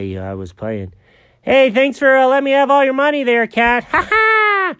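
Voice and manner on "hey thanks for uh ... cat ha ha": high gloating squirrel voice with a cackle; Chuck the squirrel. Acted. Squirrel Chuck taunting Jenkins, high gloating. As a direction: crow over the winnings, high and giddy. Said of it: "turns out he's pretty terrible".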